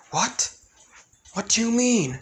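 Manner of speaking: high startled question; confused. Acted. Alexa questions Izuku's warning, high startled surprise. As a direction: caught off guard, asking sharply.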